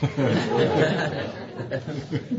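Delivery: a real burst of laughter, rising; amused laugh. Real, not acted. Speaker recalling the invitation, laughing openly.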